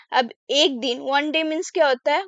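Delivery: ordinary talk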